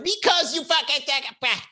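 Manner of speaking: very high mock outburst; high squawky retort; imitated yelling. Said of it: "Why are you yelling?".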